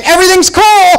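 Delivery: high strained fake reassurance; fake calm. Husband telling his wife all is well, strained high fake calm. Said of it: "looking down at my wife and saying".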